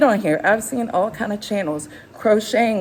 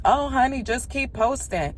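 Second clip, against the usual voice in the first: higher, sugary honey tone sliding down soft and slow; mock sweet advice; 6 semitones higher, 3 dB quieter than usual. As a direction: imitate a patronizing well-wisher. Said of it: "when people say".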